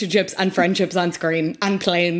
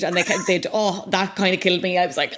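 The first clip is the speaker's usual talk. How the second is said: ordinary talk, nothing marked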